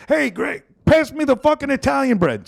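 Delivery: high, loud mock yell for the bread; Italian relative yelling. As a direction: bark it across the table, Brooklyn style. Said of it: "And then they'd be like".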